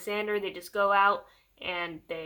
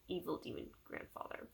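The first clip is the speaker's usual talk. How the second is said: breathy mock-ominous whisper, much softer; spooky whisper; 3 semitones lower, 15 dB quieter than usual. Shown as a demonstration. Vlogger teasing the villain's silly name: soft spooky whisper. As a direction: whisper the name like a horror trailer.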